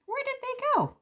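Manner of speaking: very high, puzzled little seed; tiny squeaky bewilderment. Acted. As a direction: search for the lost seeds, squeaking. Character loses tiny seeds.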